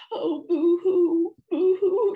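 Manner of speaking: high, loud, drawn-out boo-hoo; cartoonish, noisy crying; theatrical wailing. A narrator's reading. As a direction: wail it out in hammed-up sobs. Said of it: "she wailed".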